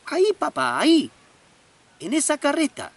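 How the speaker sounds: put-on high, loud eager child; insistent child. Little boy insists to father, high and eager.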